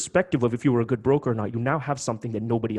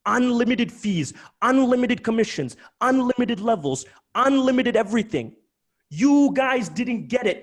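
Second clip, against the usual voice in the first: higher, louder, slow emphatic list; animated; 10 semitones higher, 5 dB louder than usual. Real, not acted. Host stressing how generous the license was, loud and animated. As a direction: hammer each unlimited home. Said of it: "I'm just speaking animated".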